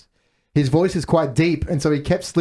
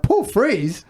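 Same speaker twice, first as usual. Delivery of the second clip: higher-pitched retold disbelief; incredulous; 8 semitones higher than usual. Podcast host relives noticing a weird connection, mildly incredulous. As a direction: question the name in mock bafflement. Acts own baffled reaction to connection.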